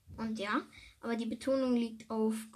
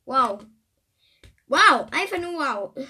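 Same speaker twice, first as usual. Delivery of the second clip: a big sarcastic wow, louder; loud mock wow; 2 semitones higher, 10 dB louder than usual. Real, not acted. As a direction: shout the wows, teasing yourself. Mock amazement at own word.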